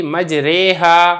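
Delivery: مجریہا with the ra leaning toward e, said slowly; slow demo of مجریہا with imala; imala pronunciation. Shown as a demonstration. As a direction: draw out مجریہا with the tilted e vowel. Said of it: "جب آپ نے اس لفظ کو پڑھنا ہے".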